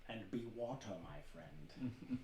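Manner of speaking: soft, low, slow Bruce Lee voice, dropping low on friend; a hushed Bruce Lee, sinking into the last word; Bruce Lee impression. Host doing Bruce Lee for his co-hosts, low and hushed. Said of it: "wicked Bruce Lee impersonation".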